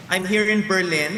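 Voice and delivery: ordinary talk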